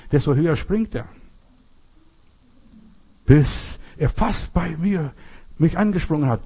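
normal talking voice

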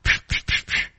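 unvoiced psch to chase off a scorpion; shooing hiss. Shown as a demonstration. Preacher shows how to drive off scorpions, shooing hiss. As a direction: hiss it away like shooing a pest. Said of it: "Schlangen und Skorpione zu vertreiben".

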